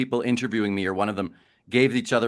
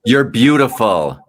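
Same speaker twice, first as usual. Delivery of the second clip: loud slow shout; 11 dB louder, slower than usual. Pretend. Answering the host's question by shouting the compliment, slow and loud. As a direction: shout it out slow and much louder. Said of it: "why not just shout".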